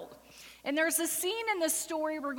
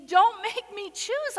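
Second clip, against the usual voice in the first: raised, louder anguished begging; mother pleading not to be made to choose; desperate plea; 7 semitones higher, 5 dB louder than usual. A narrator's reading. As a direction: beg as a mother forced to choose. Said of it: "And she pleads".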